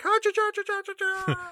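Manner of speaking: high, hammed-up leprechaun Irish accent squeal; mock leprechaun brogue. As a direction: squeal it like a cartoon leprechaun flying through the air. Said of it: "does not have an accent like this".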